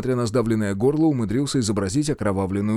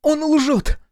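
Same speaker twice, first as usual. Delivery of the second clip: very high-pitched protest; cry of denial; 3 dB louder than usual. A narrator's reading. Wife calls the envoy a liar, crying out. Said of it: "выкрикнула Елена".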